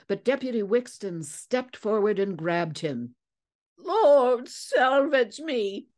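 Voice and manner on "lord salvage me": put-on high, loud, drawn-out old seadog cry; creaky shout. A narrator's reading. Captured man cries out as deputy grabs him, creaky shout. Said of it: "shouting in a creaky voice".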